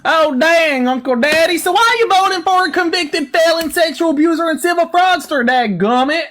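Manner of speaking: high, twangy yokel put-on; hillbilly interrogator. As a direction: grill them like an exasperated country bumpkin. Mock hillbilly host questions guest.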